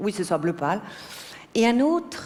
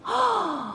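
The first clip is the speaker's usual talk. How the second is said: long, high groan of boredom; exasperated high "oh"; 12 semitones higher than usual. Acted. Wife telling husband how he bores her, exasperated groan. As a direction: let out a drawn-out, high exasperated sigh.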